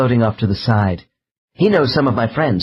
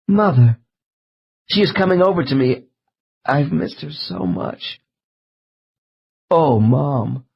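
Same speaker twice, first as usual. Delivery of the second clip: tearful reunion; 3 semitones higher than usual. A narrator's reading. Hypnosis subject greeting her dead mother, tearful. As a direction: voice catching as she sees her mother. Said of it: "Subject begins to cry again".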